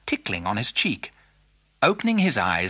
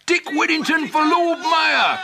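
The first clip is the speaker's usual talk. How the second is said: very high, loud rallying shout; crowd cheer; 6 dB louder than usual. Acted. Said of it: "cried the people".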